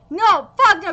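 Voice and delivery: loud outraged repeat; furious refusal. Real, not acted. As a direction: spit it out in anger. Wrong composer named.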